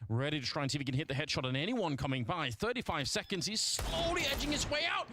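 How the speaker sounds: rising live play-by-play call; esports caster. Acted. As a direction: build the call as the shot comes.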